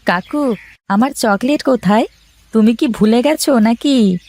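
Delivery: put-on high, eager little boy asking his uncle; a boy asking his uncle for chocolate; child's voice. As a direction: pitch it up like a small child begging for sweets.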